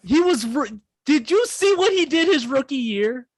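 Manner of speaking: high excited outburst. Real, not acted. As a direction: fire back, worked up. Heated defense of RG3.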